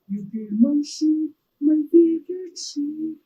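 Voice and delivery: high, loud, slow bellowing monster; angry Cyclops. Retold or imitated. Cyclops mourning his sheep, loud and slow. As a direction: wail it like a furious giant over his sheep.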